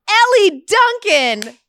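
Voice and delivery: big stage introduction; mock announcer. Host mimics a charity emcee grandly mispronouncing her name. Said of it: "And she's like".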